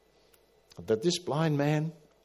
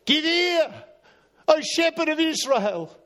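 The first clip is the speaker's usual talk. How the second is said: high, louder plea to God; psalm cry; 6 dB louder than usual. Retold or imitated. Asaph crying out to the shepherd of Israel, high louder plea. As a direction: call out the psalm like a prayer.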